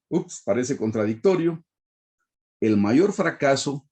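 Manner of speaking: normal talking voice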